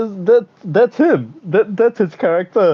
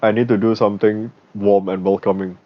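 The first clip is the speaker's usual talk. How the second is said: lower, earnest mock prime minister; imitating the prime minister; 9 semitones lower than usual. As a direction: voice him low and serious, lightly acted. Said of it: "he goes to his team like".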